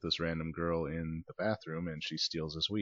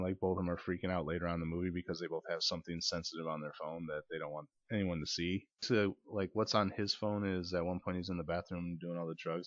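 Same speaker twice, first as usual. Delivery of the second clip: ordinary delivery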